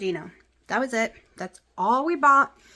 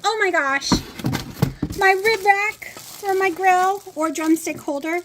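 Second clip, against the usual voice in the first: giddy excitement; 9 semitones higher, 5 dB louder than usual. Real, not acted. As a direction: gush over the gift. Opening a gift box.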